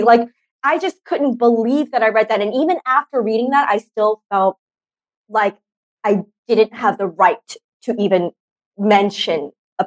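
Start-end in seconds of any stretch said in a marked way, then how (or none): none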